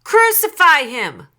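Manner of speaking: high, loud mob yell; forceful crowd cry. A narrator's reading. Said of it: "they shouted all the louder".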